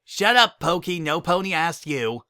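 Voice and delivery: yelled. Acted. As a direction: shout her off from across the house. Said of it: "yelled into the kitchen".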